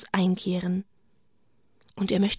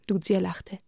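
plain reading voice

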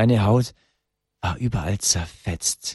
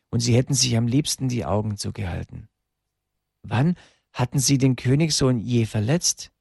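usual speaking voice

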